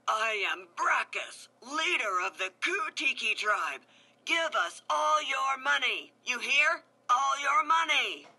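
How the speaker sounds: loud bullying villain demand; shouted. A narrator's reading. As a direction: bellow at the whole town. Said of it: "Brackus shouted".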